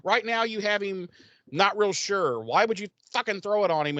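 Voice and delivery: high, incredulous pleading at her; exasperated outburst. Retold or imitated. Podcaster yelling at the TV character. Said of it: "and I'm like".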